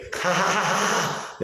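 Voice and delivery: high-pitched mock maniac cackle; maniacal laugh. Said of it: "And a maniacal kind of laugh".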